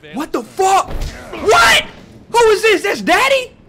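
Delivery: very high, loud shocked yell. Real, not acted. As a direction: yell at the sudden surprise. Daddy appears suddenly.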